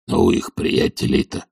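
low, husky grumble; hoarse, reluctant retainer; grumbling old servant. A narrator's reading. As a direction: mumble it down in the throat. Zakhar's character voice.

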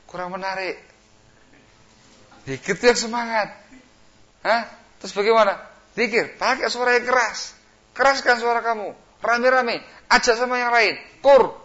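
high, loud rousing push; devil egging on. Pretend. Iblis urging a worshipper to chant loudly, rousing. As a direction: rouse him to shout his zikir.

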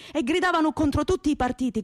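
ordinary delivery

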